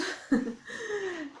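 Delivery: high laugh at her own clumsiness; real laugh after an oops; short embarrassed laugh. Real, not acted. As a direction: let a sheepish giggle out. Laughs after dropping something.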